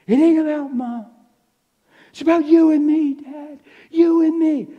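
put-on high, loud, pleading cry of a frightened boy; son's desperate outburst. Son yelling at his dad in the hospital, high and loud. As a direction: shout it like a kid losing it. Distraught son shouting at father.

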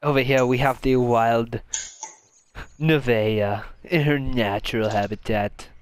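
nature documentary. Pretend. Narrates friend as wild animal.

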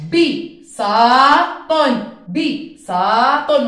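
بي صا طن split loud and slow; syllable segmentation. Shown as a demonstration. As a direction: beat out بي صا طن. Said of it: "نقطع صوتي". (تقطيع بي صا طن)

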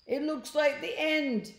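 hammed-up cornered panic; mock despair. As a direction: lament it as if doomed. Character despairs, cornered by cats.

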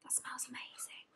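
unvoiced whisper; awed whisper. Real, not acted. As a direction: breathe it out in wonder. Hushed awe while unboxing.

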